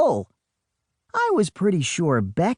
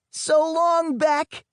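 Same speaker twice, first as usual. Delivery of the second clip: high, raised call over the waves; shouted farewell; 13 semitones higher, slower than usual. Acted. Boy shouts goodbye to his twin among sharks, hammed-up yell. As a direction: yell a dramatic goodbye while thrashing. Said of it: "I shouted".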